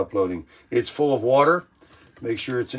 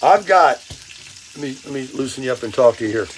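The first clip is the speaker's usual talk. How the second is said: normal talking voice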